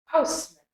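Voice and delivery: Postman with a faint "mun" ending; schwa. Shown as a demonstration. Teacher saying Postman with learners, schwa ending. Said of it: "Can you say it with me?".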